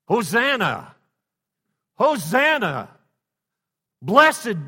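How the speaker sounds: loud, high joyful cry of Hosanna; ringing high festive shout; crowd shout. Acted. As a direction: call it out like the cheering crowd. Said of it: "what they were shouting and singing".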